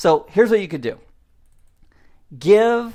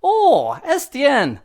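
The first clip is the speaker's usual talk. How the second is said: high, loud comic Scottish wail; Scotty; 10 semitones higher, 4 dB louder, slower than usual. Acted. Mocking his own self-talk, exaggerated high Scottish accent. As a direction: ham up a funny Scottish accent. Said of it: "I take my Scotty voice out".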